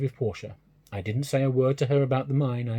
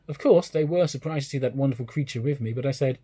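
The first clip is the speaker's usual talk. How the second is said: ordinary delivery, nothing marked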